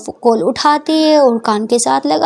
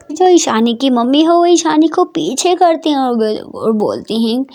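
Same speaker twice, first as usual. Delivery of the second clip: plain reading voice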